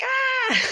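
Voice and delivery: very high mock scream; high-pitched reenacted cry of alarm; retold scream. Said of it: "So I start screaming".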